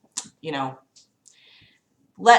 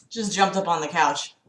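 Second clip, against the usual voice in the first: usual speaking voice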